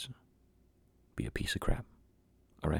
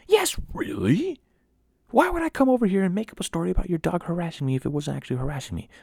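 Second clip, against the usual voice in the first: high, loud mock outrage; indignant retort; 13 semitones higher, 7 dB louder than usual. Boy answering the doubting neighbor, loud high indignation. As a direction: throw it back loudly in exasperated disbelief.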